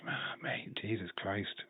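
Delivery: whispered disbelief after a great match; awed whisper. Real, not acted. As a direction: breathe it out, stunned.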